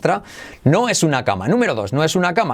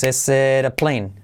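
"is it a plane" slow, linked as "ésera plane"; slow repeat model; slower than usual. Shown as a demonstration. ("is it a plane" lento, para repetir)

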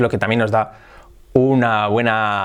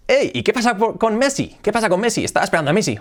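a viewer asking, pitched well higher; curious viewer; 8 semitones higher than usual. Pretend. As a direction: ask about Messi like an eager fan. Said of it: "mucha gente os preguntaréis". (un espectador que pregunta intrigado, con voz más aguda)

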